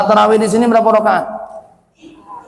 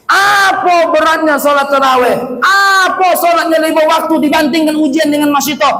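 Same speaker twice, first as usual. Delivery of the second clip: fiery preaching; 8 semitones higher than usual. Acted. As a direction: press the congregation with rising questions. Preaching rebuke to congregation.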